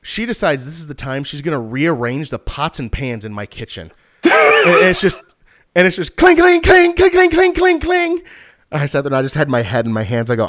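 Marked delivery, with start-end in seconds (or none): 4.9-8.2 high, loud sing-song cling cling; high loud clanging imitation; mock clanging. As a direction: imitate pots clanging, pitched way up.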